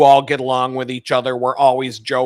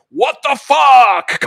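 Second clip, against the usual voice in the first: much higher, loud, slow shout; mock outraged shout; 10 semitones higher, 6 dB louder, much slower than usual. Reading the site's welcome banner to listeners, slow mock shout. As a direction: blast each word out like a giant headline. Mock-reads the error message.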